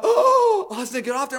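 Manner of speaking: high, loud laughing call; half laughing as she shoos the toddler off; laughing scold. Retold or imitated. Sister calling the toddler off the cement, laughing and high. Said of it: "my sister went".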